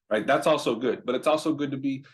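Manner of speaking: ordinary talk, nothing marked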